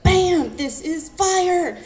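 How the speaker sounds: loud, high burst of excitement; excited exclamation. Retold or imitated. Poet describing meeting her creative partner, excited exclamation. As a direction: punch it out, then stretch the last word.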